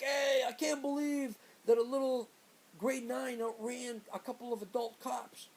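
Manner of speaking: loud, high crowing in amazement; gleeful Dwayne. Retold or imitated. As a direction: crow it like a cocky, amused teen. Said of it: "Dwayne's laughing. And he's like".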